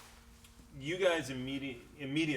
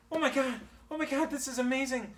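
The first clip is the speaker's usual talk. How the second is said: overjoyed; 11 semitones higher than usual. Acted. GM voices cured Calvin rejoicing.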